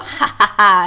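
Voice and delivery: loud cackling ha ha ha; witch cackle. Pretend. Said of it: "done in a witch voice".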